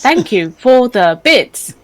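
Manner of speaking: emotionless text-to-speech bot; flat, slow robotic read. Shown as a demonstration. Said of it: "emotionless robotic voice".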